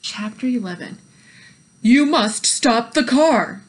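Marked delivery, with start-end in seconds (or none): 1.8-3.6 loud, slow police order; loud, slow shouted command. A narrator's reading. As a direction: bark the order across the road. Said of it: "shouted Officer Tomillo".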